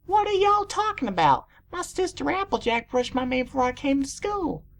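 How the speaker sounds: high-pitched Southern filly; strong Southern accent. A narrator's reading. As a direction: chirp it with a Southern twang. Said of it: "with a strong southern accent".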